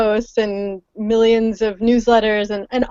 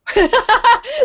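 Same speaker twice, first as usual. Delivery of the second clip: a much higher laugh on a rise; surprised laugh; 13 semitones higher than usual. Real, not acted. Guest laughs realizing the call is for her. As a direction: laugh at the discovery.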